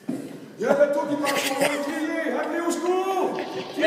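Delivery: high, loud, hurried orders; urgent hypnotist's commands. Acted. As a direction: push them to bail the boat fast. Storm scene, urgent commands.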